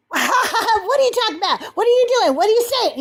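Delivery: high-pitched mocking heckler, questions fired in a row; mimicked disruptive attitude. Imitating the guest butting in on Zena May, mock belligerent. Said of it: "let me show you his attitude".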